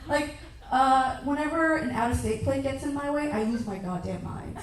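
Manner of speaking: usual speaking voice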